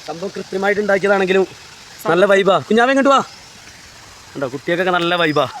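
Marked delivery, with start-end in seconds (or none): 2.7-3.2 high, loud coaxing call to a little one. Real, not acted. Calling a baby over.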